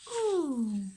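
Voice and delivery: ordinary talk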